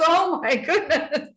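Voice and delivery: high, slow, laughing exclamation. Retold or imitated. Doctor laughing at the patient's tears. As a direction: laugh it out in amused disbelief.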